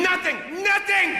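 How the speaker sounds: high shouted proclamation; proud shout. Pretend. Westerner proudly answers what he believes in, loud shout.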